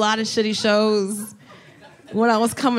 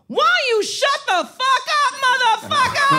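mom's furious yell. Retold or imitated. Mom yelling at a heckler.

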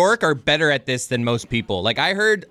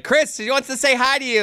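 lightly voiced mom, higher pitch; 9 semitones higher than usual. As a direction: relay it like a sweet mom. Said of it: "my mom would be like".

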